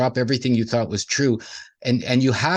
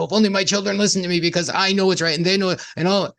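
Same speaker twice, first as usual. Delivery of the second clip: mock parent lament; 9 semitones higher than usual. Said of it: "mothers and fathers ... that says".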